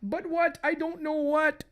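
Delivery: high-pitched acted bewilderment; panicked wife. Wife answers possessed husband, high panic.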